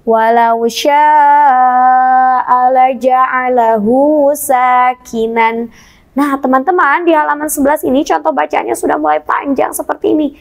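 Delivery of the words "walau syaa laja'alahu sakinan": slow chanted recitation; Quran recitation. Shown as a demonstration. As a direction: recite it slowly for class to follow. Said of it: "Kita baca sama-sama".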